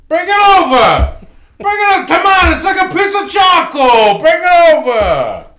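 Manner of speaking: full-volume, high-pitched movie tough guy tantrum; explosive shouting, voice way up, last words stretched; Jake LaMotta. Acted. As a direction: yell it like an enraged husband demanding dinner. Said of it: "Jake LaMotta, when he's just like".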